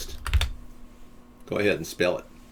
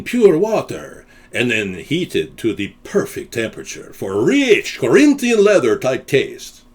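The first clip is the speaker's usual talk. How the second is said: louder, savouring the words like a coffee commercial; ad pitch; 5 dB louder than usual. Pretend.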